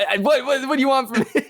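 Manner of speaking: high, laughing exasperation; what do you want from me, half laughing; exasperated. Real, not acted. Host interrupted by laughing cohost, high exasperated.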